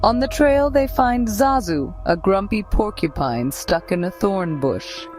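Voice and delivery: ordinary delivery, nothing marked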